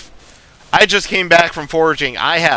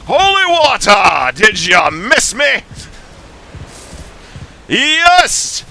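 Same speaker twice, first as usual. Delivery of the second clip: Alucard; 4 semitones higher than usual. Shown as a demonstration. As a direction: taunt a priest with mad glee. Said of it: "I can do Alucard".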